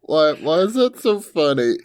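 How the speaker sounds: high, loud question through laughter; helpless laughter. Real, not acted. Co-host broken by a stupid tea pun, helpless laughter. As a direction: struggle to ask while cracking up.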